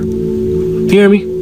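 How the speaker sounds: high, breathy rallying call; booming motivational speech. Real, not acted. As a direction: fire the crowd up. Hyping up the audience.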